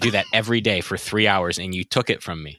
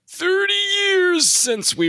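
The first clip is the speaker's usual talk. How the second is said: mock dramatic voice; much slower than usual. Jokey announcer exaggeration.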